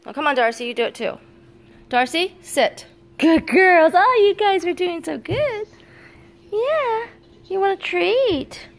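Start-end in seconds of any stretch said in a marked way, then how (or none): 3.2-8.7 much higher, slow, sing-song praise for the puppies; high dog-praise voice. Real, not acted. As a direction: gush over the dogs like they're babies.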